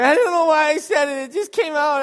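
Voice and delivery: put-on high, very loud childish wail; mock bawling. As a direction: blubber an excuse at full volume, hammed up. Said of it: "start bawling".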